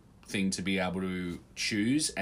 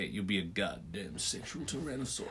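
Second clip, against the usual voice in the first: low-key put-on film voice, much softer; Predator movie line; 3 semitones lower, 10 dB quieter than usual. Co-host quoting a film line back, soft movie impression. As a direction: quote the action film under your breath. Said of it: "what's the line from Predator?".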